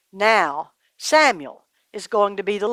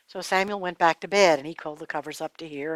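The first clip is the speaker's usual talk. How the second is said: ordinary delivery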